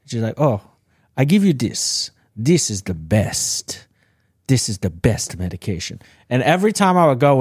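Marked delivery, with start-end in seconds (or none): 0.4-6.0 put-on Haitian accent. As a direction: imitate the Haitian psychiatrist's accent, drawing the words out. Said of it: "'cause of her accent".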